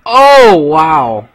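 high, loud "oh, wow" of surprise; real surprise, the pitch jumps up; startled gasp of wonder; surprised. Real, not acted. Reacting in game.

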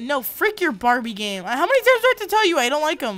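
high, loud, fast scolding; exasperated rant. Acted. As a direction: rattle it off, fed up. Refusing Barbie game.

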